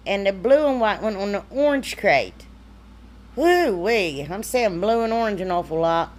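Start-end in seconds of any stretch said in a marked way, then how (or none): none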